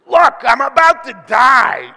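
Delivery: milk it like a melodramatic diva; drama queen. Acted. Pastor mocking Esau as a drama queen, wailing. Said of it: "turns from the big hairy man into the drama queen".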